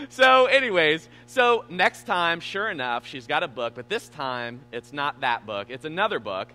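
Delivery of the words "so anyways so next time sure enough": normal talking voice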